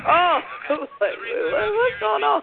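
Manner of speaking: high laughing surprise; startled laugh into the question; surprised laugh. Real, not acted. Reacting to shocking video.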